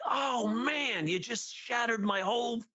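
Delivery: hammed-up frustrated husband; exasperated. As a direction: groan at the interrupted flow. And I'm like.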